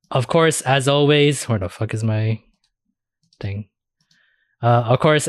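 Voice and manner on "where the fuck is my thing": normal talking voice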